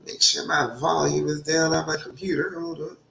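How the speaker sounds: ordinary delivery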